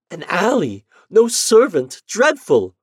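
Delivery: high, loud horrified outburst; jealous wife hearing bad news; shocked. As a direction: gasp it out in alarm.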